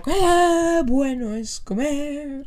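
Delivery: slow drawn-out imitation of the contestant; mock contestant sing-song. Mimicking the casting singer, slow and hammed-up. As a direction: stretch each word like the singer. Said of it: "levantaba la mano".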